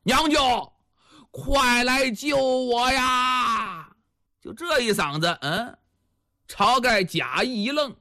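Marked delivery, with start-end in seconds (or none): 0.0-3.9 drawn-out cry of rescue me; sudden shout for help. Acted. Captive suddenly calling to his supposed uncle, long-drawn shout. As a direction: call out high, stretching the last words very long. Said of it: "那大汉突然间就喊上了".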